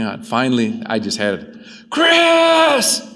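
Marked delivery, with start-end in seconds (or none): 1.9-3.1 high loud yell across the cemetery. Retold or imitated. Scared boy yells for his uncle in the cemetery, loud. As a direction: shout the name into the dark. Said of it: "I'm yelling".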